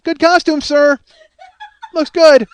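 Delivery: high, loud sing-song compliment; mock wife. Husband mocks his wife's compliment to the sick man, high and loud. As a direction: overdo the cheery greeting.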